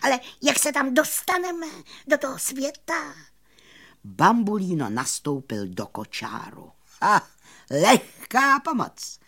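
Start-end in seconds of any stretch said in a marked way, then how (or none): 0.0-3.1 very high, quick squeaky question; excited cricket. A narrator's reading. Cricket asking the magician about the way, high eager squeak. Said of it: "rozářil se Cvrček". 7.0-9.2 high, loud, stretched triumphant cry; exclaiming magician. A narrator's reading. Magician offering the musicians a ride, high confident exclamation.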